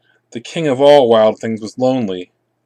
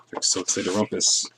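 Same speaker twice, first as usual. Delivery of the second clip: usual speaking voice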